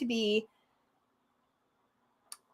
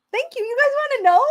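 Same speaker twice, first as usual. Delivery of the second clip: overwhelmed thanks; 13 semitones higher, 4 dB louder, faster than usual. Real, not acted. Overwhelmed by the signup count.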